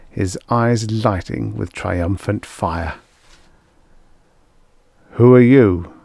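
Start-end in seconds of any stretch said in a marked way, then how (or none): 5.1-5.9 loud, challenging question from the priest. A narrator's reading. Priest confronting a stranger, loud questioning. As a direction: demand it of a stranger. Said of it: "the priest asked".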